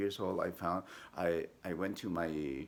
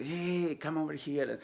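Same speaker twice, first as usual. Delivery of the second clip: calling out; 10 semitones higher than usual. Retold or imitated. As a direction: call them over to come look. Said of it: "so I said".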